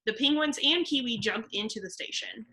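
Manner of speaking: ordinary talk, nothing marked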